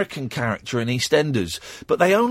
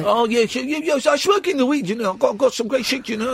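high-pitched mock Dutch stoner accent; hammed-up chompy Dutch accent; crazy Dutch accent; 7 semitones higher than usual. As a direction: ramble as a Dutch caricature, chewing words in the back teeth. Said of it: "I'm a crazy Dutch guy".

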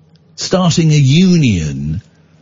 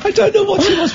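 laughing. Real, not acted. Laughing about son's line.

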